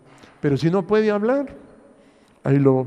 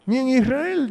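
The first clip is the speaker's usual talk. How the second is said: "Ni en Israel" said much higher, with a falling tune on "Israel"; stress on Israel; 8 semitones higher than usual. Shown as a demonstration. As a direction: hammer home "Israel". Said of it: "Oiga bien". (énfasis agudo en "Israel")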